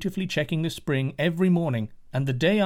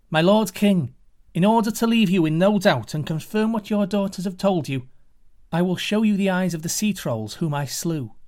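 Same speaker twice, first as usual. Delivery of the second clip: put-on raised, loud, proud young hero's voice; 5 semitones higher, 4 dB louder than usual. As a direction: address the king boldly, a lightly voiced hero. Hero addresses the king.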